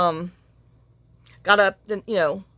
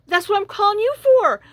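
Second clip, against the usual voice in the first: high, loud retold exasperation; acted-out frazzled snap at son; exasperated retort; 12 semitones higher, 5 dB louder than usual. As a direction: throw it back, high-pitched and exasperated.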